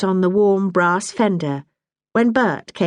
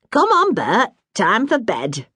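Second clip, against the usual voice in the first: lightly raised voice of a plain-spoken mother; a hint of a brisk northern mum; Bessie, motherly Yorkshire woman; 6 semitones higher than usual. Bessie to husband.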